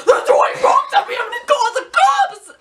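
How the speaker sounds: high, loud mock outrage; hammed-up angry shouting; screaming dad. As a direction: yell threats like a frantic dad. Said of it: "then he's like".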